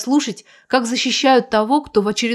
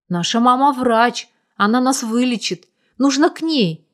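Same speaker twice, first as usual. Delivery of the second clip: ordinary delivery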